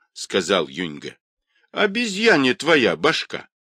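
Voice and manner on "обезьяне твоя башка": high, sharp retort; old woman's cry. Acted. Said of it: "крикнула старуха".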